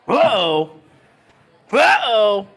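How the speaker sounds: loud, high exclamation of amazement; shocked whoa. Real, not acted. Commentator reacting to a dart throw, shocked shout.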